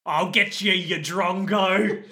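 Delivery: broad mock Australian, last word dragged out slowly; exaggerated Aussie fighter's threat; Australian accent. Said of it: "Australian Liu Kang shows up and is like".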